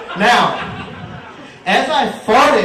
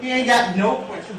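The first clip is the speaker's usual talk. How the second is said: ordinary talk, nothing marked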